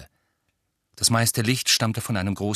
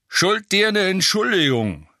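loud grunting rogue voice; 10 semitones higher, 7 dB louder than usual. Acted. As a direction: grunt it out from the smoke. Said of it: "grunzte eine Stimme".

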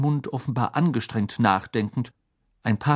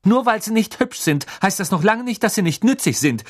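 high, louder indignant burst; blurted protest; 11 semitones higher, 4 dB louder than usual. A narrator's reading. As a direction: snap back in defence. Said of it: "stieß Hermine hervor". (empört hervorgestoßen, hoch und laut)

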